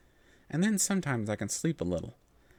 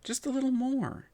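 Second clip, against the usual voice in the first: put-on much higher, wheedling child's voice; pouting plea; 13 semitones higher than usual. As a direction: beg sweetly for one more page. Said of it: "I said, pouting again".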